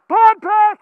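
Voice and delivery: shouted callout. Real, not acted. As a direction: yell it to your squad.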